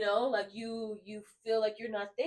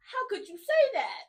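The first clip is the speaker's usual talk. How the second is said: higher and louder, laughing, hammed-up hurt disbelief; mock offended disbelief; 9 semitones higher, 6 dB louder than usual. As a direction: playfully fake outrage with a laugh on it. Mock outrage at being told off.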